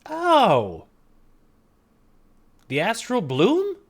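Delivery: very high, bursting exclamations; hammed-up eureka, pitch shooting up; sudden realisation. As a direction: blurt the easy fix in amazement.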